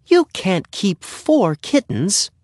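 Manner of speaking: high, slow motherly objection; protesting mother. A narrator's reading. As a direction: plead with your son, pitched high. Mother objecting to four kittens.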